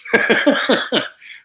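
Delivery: high, airy burst of laughter; real laugh. Real, not acted. Laughing at being caught staring by clients, real laugh. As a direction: break up laughing at the story.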